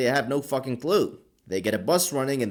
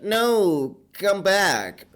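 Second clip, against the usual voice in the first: put-on higher, whiny girl voice; mock pleading girl; 6 semitones higher, 3 dB louder, much slower than usual. Girl begging Takashi to come back, higher whiny voice. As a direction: whine at him to come back. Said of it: "But then she's like".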